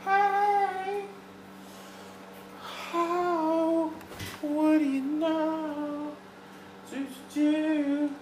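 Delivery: high, slow, creaky granny voice; drawn-out old lady voice; old woman voice. Pretend. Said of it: "You doing your old woman voice?".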